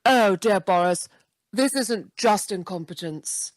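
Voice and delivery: posh, sultry advert-style voice; Joanna Lumley. Acted. Joanna sent in to scold Boris, posh sexy voice. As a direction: savour each phrase in plummy English, with pauses between. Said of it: "send Joanna in and say".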